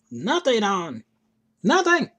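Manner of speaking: loud, high exasperated yell; frustrated shout. Real, not acted. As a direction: blurt it out in exasperation.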